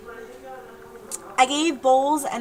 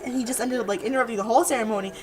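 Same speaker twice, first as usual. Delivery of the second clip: usual speaking voice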